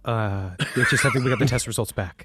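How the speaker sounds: low, soft, grave doctor's news voice; deep serious voice. Shown as a demonstration. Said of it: "This is my serious voice".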